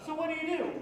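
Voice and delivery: ordinary delivery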